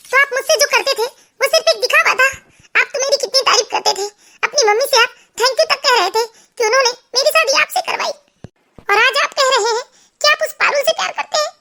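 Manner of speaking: high-pitched wounded disbelief; heartbroken wife. Acted. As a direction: question his love in a high, hurt voice. Narrator plays shocked, betrayed wife. (टूटे दिल वाली पत्नी, ऊँचे सुर में)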